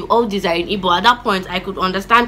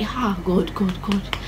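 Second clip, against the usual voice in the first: soft retold plea under the breath; fearful muttering; 8 dB quieter, much slower than usual. Shaking before mom, softly muttering to God. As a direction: murmur "God" like a scared child.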